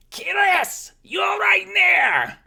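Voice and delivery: very high, loud calling scream; half-orc screaming to her neighbor. Acted. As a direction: yell it across the street.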